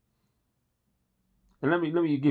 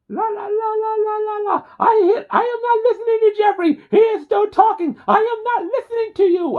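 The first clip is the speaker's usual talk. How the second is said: very high, louder falsetto sing-song; Beverly Hills Cop line; 6 dB louder than usual. Retold or imitated. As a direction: squeak it out like a childish brat blocking his ears. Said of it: "here's my favorite line from Beverly Hills Cop".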